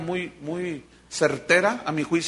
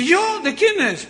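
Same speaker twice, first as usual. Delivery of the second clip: high, loud startled yo with a laugh; startled denial; 11 semitones higher, 4 dB louder, slower than usual. Acted. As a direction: play innocent, caught off guard. Peter denies, startled and laughing.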